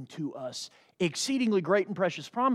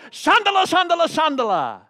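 tongues; 7 semitones higher, 6 dB louder than usual. Retold or imitated. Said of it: "one person on my left going".

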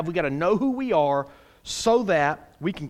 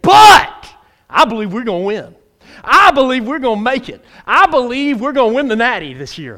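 loud, raised, rallying cry; fervent fan's declaration; 4 semitones higher, 8 dB louder than usual. Acted. Preacher voices a football fan believing in the team, fervent. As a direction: declare it like a die-hard fan, hitting each opening hard.

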